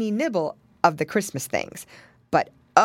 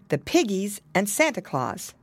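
usual speaking voice

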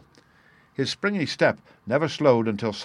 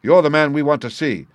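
quick and clear; 4 semitones higher, 6 dB louder than usual. A narrator's reading. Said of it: "he said in a quick, clear way".

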